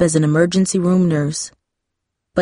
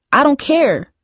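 pitched-up defiant retort, understated; 9 semitones higher than usual. A narrator's reading. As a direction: shut her sister down. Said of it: "Jamie said defiantly".

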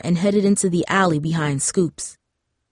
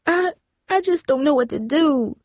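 breaking, much higher, tearful stammer; on the edge of crying; beginning to sob; 8 semitones higher than usual. A narrator's reading. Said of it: "beginning to sob".

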